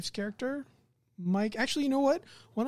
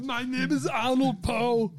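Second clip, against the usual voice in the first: slow, higher put-on accent; Arnold character voice; 4 semitones higher, slower than usual. Game master echoing the player's character, Arnold impression. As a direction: draw it out like a mock action hero. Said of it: "in that accent for that voice".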